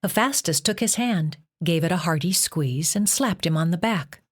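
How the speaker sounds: ordinary delivery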